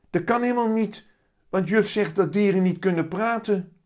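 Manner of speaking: put-on high, loud amazed little boy; astonished exclamation. A narrator's reading. Willem hears his cat speak, astonished high cry. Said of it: "roept verbaasd".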